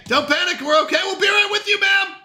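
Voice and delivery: frantic barista calling out. Acted. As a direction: hurry to reassure the waiting customer. Reassuring waiting customers, rushed.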